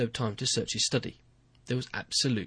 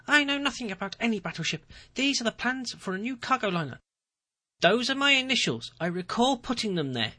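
much higher, wide-eyed denial; innocent-acting president; 11 semitones higher than usual. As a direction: play the baffled innocent. Ferrero denies, feigning innocence.

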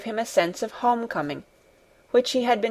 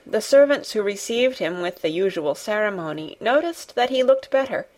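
ordinary delivery, nothing marked

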